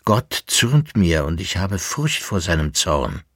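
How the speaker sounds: usual speaking voice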